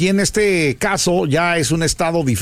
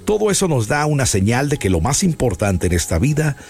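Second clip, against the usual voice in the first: solemn reflection. Acted.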